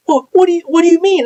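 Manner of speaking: stunned question. Retold or imitated. Supervisor caught off guard by coworker's insult, high stunned whine. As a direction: squeak it out in disbelief.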